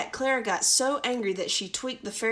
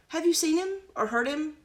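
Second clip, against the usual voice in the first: Clara's voice; 7 semitones higher, 3 dB louder than usual. Acted. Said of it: "said Clara".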